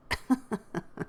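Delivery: short, soft chuckle; real quick laugh at what was read; light, airy chuckle. Real, not acted. Laughs after the line read.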